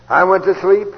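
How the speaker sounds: sleep called out loud and rising; loud emphatic repeat. Shown as a demonstration. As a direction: hammer the word sleep home. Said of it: "When I, I went to sleep".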